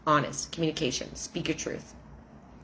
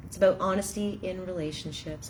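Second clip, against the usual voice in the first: ordinary talk, nothing marked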